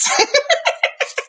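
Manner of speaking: breathy high-pitched giggle. Real, not acted. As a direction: giggle at your own singing. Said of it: "I was just joking".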